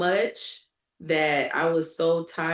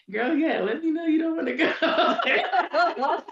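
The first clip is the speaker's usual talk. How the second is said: laughing reply; 11 semitones higher than usual. Retold or imitated. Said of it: "I'm just like".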